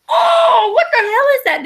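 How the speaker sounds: very high, loud startled shout; shocked shout. Retold or imitated. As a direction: yell in sudden horror at what she sees. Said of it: "I looked and I was like".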